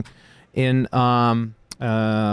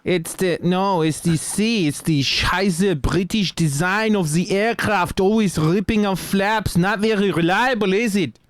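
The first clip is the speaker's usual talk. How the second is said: put-on German accent; 6 semitones higher than usual. Mocking British planes as German pilot.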